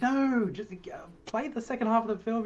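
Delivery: high voice, mimicking an impatient crowd; mock crowd demanding. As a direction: pitch it up like a grumbling audience. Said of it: "And they're like".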